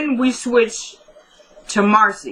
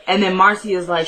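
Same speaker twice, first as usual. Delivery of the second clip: normal talking voice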